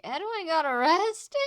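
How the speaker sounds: high, loud incredulous question; bleary incredulity. Acted. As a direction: blurt it out, pitch leaping up in disbelief. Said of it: "blearily looking at all of you like".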